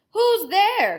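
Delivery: put-on high, loud old-lady call; Granny's call. Granny calling through the door, high and loud.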